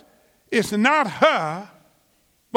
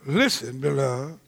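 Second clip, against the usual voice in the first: a low, much softer call to the beloved; tender low appeal; 8 semitones lower, 5 dB quieter than usual. Real, not acted. Preacher gently urging the congregation, low and soft. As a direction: lower and soften, drawing them in close.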